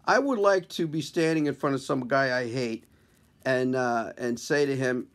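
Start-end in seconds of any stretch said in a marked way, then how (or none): none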